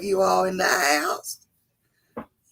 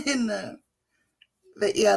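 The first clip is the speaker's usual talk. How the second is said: normal talking voice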